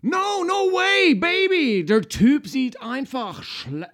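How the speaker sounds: high, slow, exaggerated; high dramatic role voice. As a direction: ham it up, drawn out. Acting a dubbing role.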